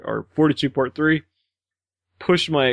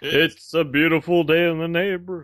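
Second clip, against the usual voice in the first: Mister Rogers voice; 5 semitones higher than usual. Pretend.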